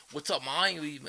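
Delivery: lightly imitated English accent that sounds Australian. Imitating a friend's greeting, light Australian-sounding English accent. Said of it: "sounds like he's Australian is [name]".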